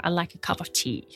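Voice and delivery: relaxed American English, said quietly; American accent. Shown as a demonstration. An American accent demonstrated for the host. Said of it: "est-ce que tu peux nous donner un petit exemple".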